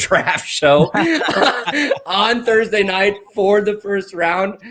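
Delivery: high, drawn-out words through real laughter; laughing. Real, not acted. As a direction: laugh your way through the announcement. Laughs announcing his own draft show.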